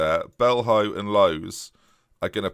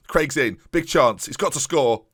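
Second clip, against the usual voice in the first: rising, louder chance call; building excitement; 5 semitones higher, 4 dB louder than usual. Real, not acted. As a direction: build it as the striker closes in. Big chance in commentary.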